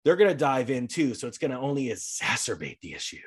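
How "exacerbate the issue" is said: EXACERBATE sounded out slowly and carefully; really slow. Real, not acted. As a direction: stretch out "exacerbate" so as not to trip on it. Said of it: "I only say it really slowly".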